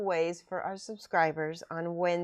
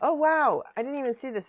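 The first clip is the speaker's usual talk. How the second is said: sudden high, loud "wow"; real surprise; 7 semitones higher, 7 dB louder than usual. Real, not acted. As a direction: genuinely caught off guard, burst out. Unboxing finds unexpected piece.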